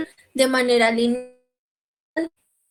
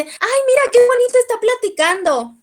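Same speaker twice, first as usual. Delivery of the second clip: much higher, louder cooing mother; doting mother; 11 semitones higher, 3 dB louder than usual. Retold or imitated. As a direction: gush like a proud mom over her baby. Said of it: "Y la mamá dice". (mamá embelesada, mucho más aguda y fuerte)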